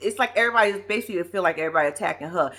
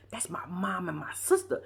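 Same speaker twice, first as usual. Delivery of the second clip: 1 whispered quote; 2 semitones lower, 8 dB quieter than usual. Retold or imitated. Husband quoted defending mother, sister.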